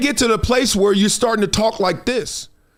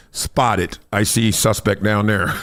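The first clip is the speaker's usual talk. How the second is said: lower, slow, clipped police radio call; mock helicopter spotter; 11 semitones lower, slower than usual. Mocking critics flying over in a helicopter, low radio voice. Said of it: "they just fly by on that helicopter like".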